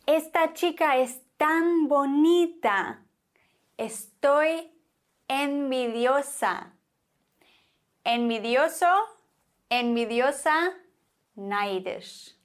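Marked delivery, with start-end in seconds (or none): none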